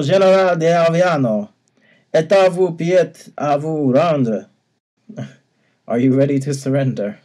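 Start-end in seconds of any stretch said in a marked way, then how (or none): 0.0-4.5 high, loud hammed-up French accent. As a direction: play the French baron with gusto. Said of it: "try to do this Valois accent".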